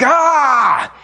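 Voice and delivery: sudden angry "Ah!" yelled high and loud; mock driver's outburst, sharp and loud; road rage yell. Cut off on the road, he yells a mock angry "Ah!". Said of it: "someone cuts you off ... you're like".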